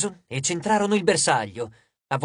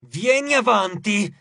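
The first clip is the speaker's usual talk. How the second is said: slow, higher-pitched angry dare; menacing, drawn-out challenge; growled challenge; 4 semitones higher, 3 dB louder, slower than usual. A narrator's reading. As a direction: growl it, daring him closer. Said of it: "ringhiò".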